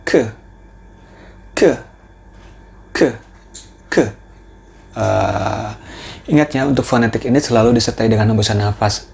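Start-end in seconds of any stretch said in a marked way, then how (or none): 0.0-3.9 "K" with a breath of air, four times; each "K" as a puff of air; aspirated "K" sound; aspirated K drilled. Shown as a demonstration. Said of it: "Fonetik berikutnya adalah".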